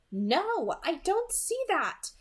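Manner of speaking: calm Rosalie down gently; Alice, reassuring. A narrator's reading. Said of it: "Alice assured her".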